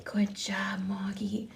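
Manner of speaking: soft, unvoiced praise; tender whisper. Real, not acted. Praising cat after birth.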